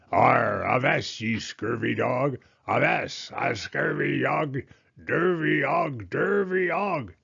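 slowing, slurred pirate bellow that climbs; bellowing pirate toy. A narrator's reading. Egg-shaped pirate toy on the floor, bellowing. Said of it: "egg-shaped pirate that bellowed".

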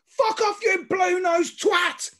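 high, loud, drawn-out imitated yell; top-of-the-voice shout. Old man abusing rival fans from his seat, imitated full shout. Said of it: "at the top of his voice".

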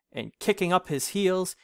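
ordinary delivery

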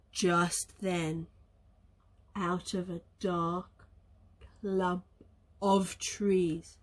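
hushed, low storybook build-up; low, soft and ominous, with pauses; suspenseful narration. A narrator's reading. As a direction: creep up on it softly. Suspense before the bear appears.

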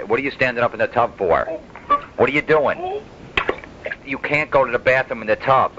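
high, exasperated scolding. Pretend. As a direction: scold him, pitch climbing. Scolding grandpa in the tub.

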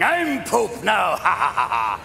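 drawn-out royal boast tumbling into a laugh; gloating Henry the Eighth. Acted. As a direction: crow it slowly, then laugh downwards. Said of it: "It was Henry the eighth going".

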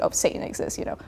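dropped to a near-whisper, musing to herself; softly voicing her own past idea; hushed inner thought. Writer recalling how she picked Satan, hushed.

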